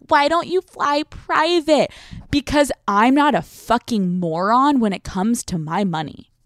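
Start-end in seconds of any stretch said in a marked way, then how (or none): 0.1-1.9 high, slow mock-innocent ask; sneering impression of people asking why she doesn't fly private; mocking question. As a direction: imitate the clueless people asking. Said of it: "when people are like".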